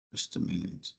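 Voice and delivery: under the breath, off mic; quick whispered aside to himself; muttered aside. Real, not acted. Aside while fixing the screen.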